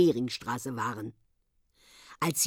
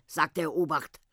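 ordinary delivery, nothing marked

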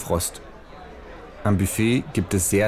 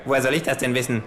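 annoyed; 6 semitones higher, faster than usual. Acted. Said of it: "fragt das Känguru verärgert".

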